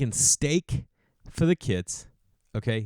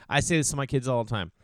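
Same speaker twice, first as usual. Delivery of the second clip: ordinary delivery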